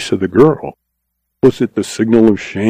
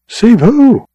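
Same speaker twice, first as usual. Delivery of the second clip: startled; 12 semitones higher, 4 dB louder than usual. Acted. Josefa startled by his claim.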